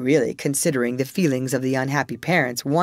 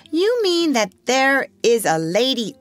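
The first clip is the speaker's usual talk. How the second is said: put-on anxious child; 8 semitones higher, 3 dB louder than usual. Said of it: "cried the first twin".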